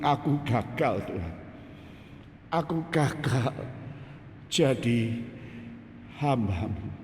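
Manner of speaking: understated, halting prayer of repentance; tearful plea for forgiveness. Retold or imitated. Preacher confessing failure to God, subdued penitent prayer. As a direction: plead in broken phrases with long pauses. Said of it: "saya nangis di situ, minta ampun".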